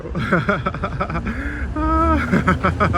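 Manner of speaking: real high-pitched delighted laugh. Real, not acted. Vlogger watching the jogger, laughing high. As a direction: burst out laughing, rising.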